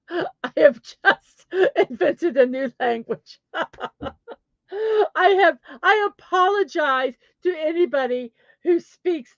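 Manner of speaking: high, broken up by laughter; helpless laughter breaking through the words; real laughing fit. Real, not acted. Apologizing to speakers of languages just mangled, laughing. Laughing at own botched pronunciation.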